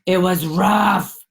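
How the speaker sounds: "It was rough" yavaş ve daha yüksek sesle; ağır ağır, vurgulu söyle. Real, not acted. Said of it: "Oh, fuck, you guys".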